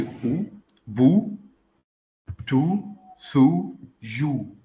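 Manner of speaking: ou, bou, tou, sou, jou, one separate syllable each; each of ou, bou, tou, sou, jou ends on a clear "oo"; dhamma "ou" sound. Shown as a demonstration. Teacher showing student the dhamma vowel, clear "ou" sound. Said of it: "on le prononce comme le son ou".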